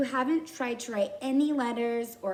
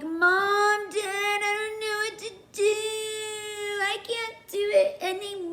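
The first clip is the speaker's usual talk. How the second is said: whining; 10 semitones higher, 3 dB louder than usual. Acted. Kid calling on mom and dad for help, whining. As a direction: whine like a child giving up. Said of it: "start whining and be like".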